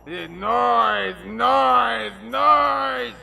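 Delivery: shouting noise, noise, noise; yelling. Acted. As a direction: bellow it, rising each time. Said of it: "Down a notch".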